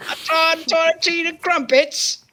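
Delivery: high mock posh British; cartoon Brit going on about tea. Cohost keeps up a high cartoon British voice. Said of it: "the stereotypical British accent".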